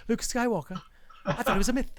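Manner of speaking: high-pitched put-on character voice; Ray. Shown as a demonstration. Co-host asked to show off an impression, high character voice. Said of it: "let's hear your Ray".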